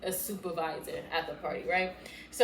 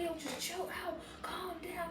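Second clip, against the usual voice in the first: put-on soft, higher-pitched boyfriend voice; retold hushed calming; 8 semitones higher, 11 dB quieter than usual. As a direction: imitate him quietly talking her down.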